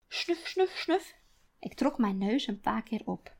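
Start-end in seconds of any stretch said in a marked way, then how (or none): 0.1-1.2 rabbit sniffs. Acted.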